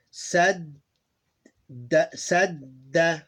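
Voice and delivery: "sadda" slowly, holding the middle d; deliberate double d in "sadda"; doubled consonant. Shown as a demonstration. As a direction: linger on the d in "sadda", then a short a. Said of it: "these two become one".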